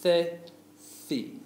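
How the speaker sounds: "The, thi" as theh, thee, emphasised; tongue-between-teeth th. Shown as a demonstration. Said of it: "stick your tongues out in between".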